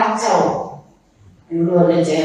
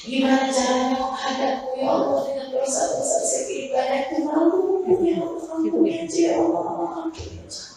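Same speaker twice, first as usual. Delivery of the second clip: pleading prayer; 6 semitones higher than usual. Acted.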